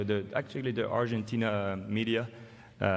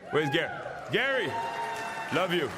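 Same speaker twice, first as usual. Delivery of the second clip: calling out; 5 semitones higher than usual. Real, not acted. Spotting Gary Payton in the crowd, shouting his name. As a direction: call across the hall to Gary.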